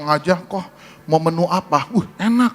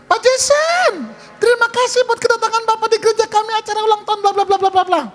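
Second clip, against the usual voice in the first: very high, singsong welcome; friendly wife. Retold or imitated. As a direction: imitate a gushing hostess. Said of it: "Istrinya datang lebih ramah". (suara istri ramah, tinggi mendayu)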